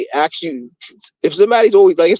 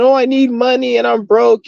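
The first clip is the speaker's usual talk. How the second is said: higher-pitched put-on victim plea; mock begging; 8 semitones higher than usual. Mocking a woman begging for money online, put-on victim plea. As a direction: imitate someone begging, pitched up. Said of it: "begging and just being a victim".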